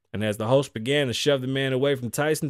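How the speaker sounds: ordinary delivery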